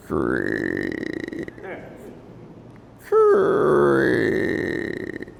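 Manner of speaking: slow, drawn-out creaking sounds; tree creaking. Retold or imitated. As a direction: creak like an old swaying tree. Imitating the tree's creaking.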